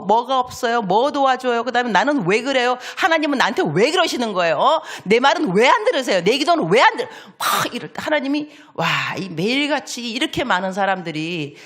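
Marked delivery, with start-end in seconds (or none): 0.0-7.1 whiny complaint. Retold or imitated. Mimicking complaining believers.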